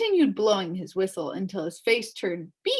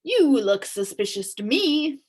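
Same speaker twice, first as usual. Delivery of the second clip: suspicious policeman; 4 semitones higher, 5 dB louder than usual. Acted. Policeman accusing humbug.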